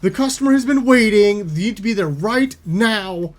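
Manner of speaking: mock an irate coworker demanding help over the intercom; imitating aggressive cashier. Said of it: "aggressively just being like".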